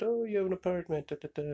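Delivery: high, quick mock woman's voice; fast high-pitched imitation; imitating the lady. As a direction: rattle it off up high like her. Voicing the blonde lady.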